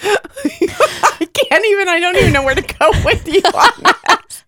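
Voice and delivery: high, laughing disbelief. Real, not acted. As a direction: giggle through the words. Reacting to old man voice.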